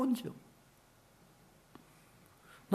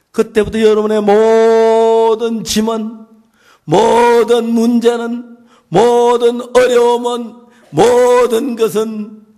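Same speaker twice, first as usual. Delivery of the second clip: louder, slow, each item punched; slow emphatic build; 5 semitones higher, 14 dB louder, much slower than usual. Acted. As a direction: lay each burden down slowly and loudly. Slow emphatic list in sermon. (천천히 힘주어 강조)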